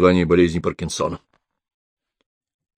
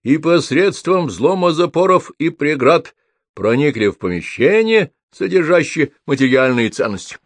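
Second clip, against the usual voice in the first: pompous report being dictated at a raised pitch; inspired dictation; 6 semitones higher than usual. A narrator's reading. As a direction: dictate grandly, drawing the words out. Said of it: "вдохновенно диктовал".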